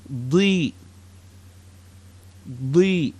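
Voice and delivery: "Di" with a heavy emphatic D. Shown as a demonstration. Teacher models the emphatic dad sound. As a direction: press the D in "Di" back and deep. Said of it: "di sound".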